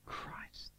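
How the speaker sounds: breathed out, barely voiced; a tearful whispered name. Real, not acted. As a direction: let the word fall to a whisper. Whispered at emotional peak.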